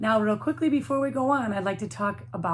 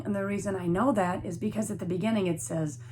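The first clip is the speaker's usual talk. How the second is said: ordinary delivery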